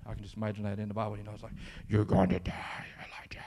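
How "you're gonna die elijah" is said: creepy low whisper like a video game villain; scary whisper. Acted. Pastor voicing the queen's threat to Elijah, creepy whisper. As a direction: hiss the threat like a horror game voice. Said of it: "That's what it sounds like".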